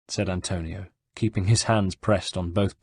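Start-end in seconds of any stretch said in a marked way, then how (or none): none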